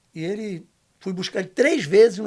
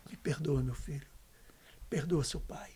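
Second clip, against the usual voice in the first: softer, fading into a whisper; father's tearful plea; 4 semitones lower, 10 dB quieter than usual. Retold or imitated. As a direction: let the plea drop to a whispered beg. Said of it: "olhou pra mim chorando e falou assim". (pai pedindo perdão, sussurrando)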